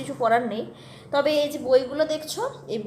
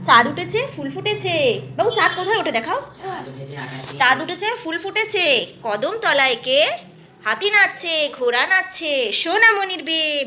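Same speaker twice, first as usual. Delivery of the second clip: louder sing-song storybook voice; reading to a toddler; 3 semitones higher, 4 dB louder than usual. Acted. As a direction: read it brightly to a little child. Mother reciting rhyme to toddler.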